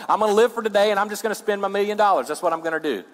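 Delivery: high, fast, carefree rush; acted heedless spender. Preacher playing someone squandering a million dollars, fast and heedless.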